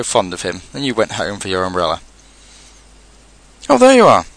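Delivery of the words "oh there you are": very high, loud call of relief. A narrator's reading. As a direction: call out loudly from up a tree. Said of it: "called down Winnie the Pooh".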